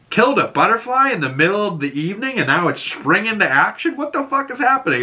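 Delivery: high, rising baffled rant; mock-incredulous wife. Wife watching from the background, high and baffled.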